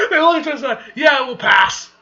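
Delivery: high, very loud comic outburst; hammed-up shout. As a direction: shout it out, cracking up at the scene. Reader cracks up, shouts the characters' refusal.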